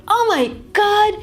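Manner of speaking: high-pitched, drawn-out amazement; amazed reaction. Shown as a demonstration. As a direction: react as if something amazing just happened. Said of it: "you react in this way".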